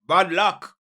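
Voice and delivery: ordinary talk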